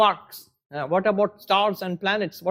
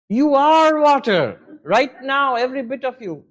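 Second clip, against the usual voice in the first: mock scolding; 6 semitones higher, 6 dB louder than usual. The wise wave rebuking the little wave, mock scolding. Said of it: "the Vedantic wave will scold him".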